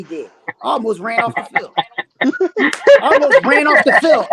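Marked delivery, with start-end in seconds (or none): 2.2-4.3 laughing. Real, not acted. Laughing while retelling the blunder.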